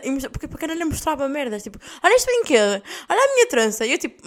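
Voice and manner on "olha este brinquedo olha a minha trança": higher, louder imitated child; kid showing off. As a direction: mimic an eager kid demanding attention. Child showing off toy and braid. (imitação de criança a exibir-se)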